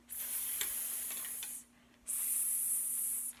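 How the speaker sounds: long, soft sustained hiss; snake hiss. Shown as a demonstration. Teacher showing children the snake sound, long soft hissing. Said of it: "The snake says".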